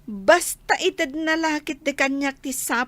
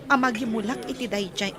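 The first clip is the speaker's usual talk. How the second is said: ordinary delivery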